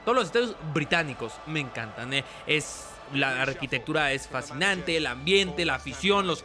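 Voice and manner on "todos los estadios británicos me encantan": normal talking voice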